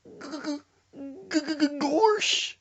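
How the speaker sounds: high stuttering cartoon yelp; Goofy. Frightened friend on the bike, hammed-up Goofy stutter. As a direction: stammer up to a drawn-out goofy exclamation. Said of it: "Now you turned into Goofy?".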